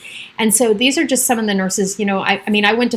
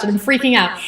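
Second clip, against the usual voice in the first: ordinary talk, nothing marked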